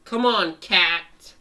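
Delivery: loud, impatient prodding; urging call. Acted. Dog urging hurt cat to fight, urging call. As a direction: call out to rouse a reluctant teammate.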